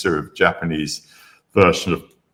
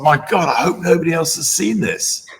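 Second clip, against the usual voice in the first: excited high thought; 11 semitones higher than usual. Retold or imitated. Private thrilled thought at brochure.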